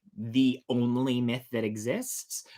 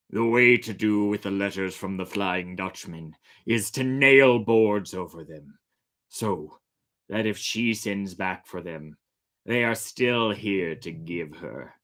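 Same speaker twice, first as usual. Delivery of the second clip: a hint of a stern captain, lower and slow; lightly voiced captain; 4 semitones lower than usual. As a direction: give the order like a ship's captain. Captain gives orders on ship.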